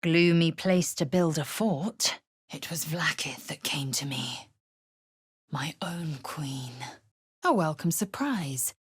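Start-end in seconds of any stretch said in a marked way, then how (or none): 2.5-6.7 hushed reverent whisper. Acted. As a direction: whisper the secret. Confiding secret.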